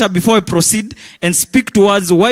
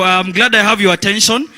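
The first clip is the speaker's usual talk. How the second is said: normal talking voice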